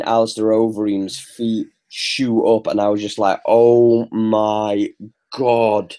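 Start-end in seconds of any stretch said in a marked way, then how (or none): 3.5-5.9 drawn-out, word-by-word awe; retold astonishment. Co-host reliving Overeem's feet shooting up, stunned. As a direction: relive a stunned reaction, stretching each word. Reliving his shocked reaction to knockout.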